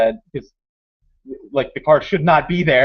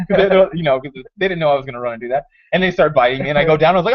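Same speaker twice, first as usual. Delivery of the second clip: ordinary delivery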